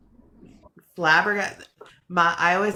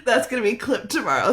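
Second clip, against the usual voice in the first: usual speaking voice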